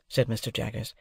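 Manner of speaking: usual speaking voice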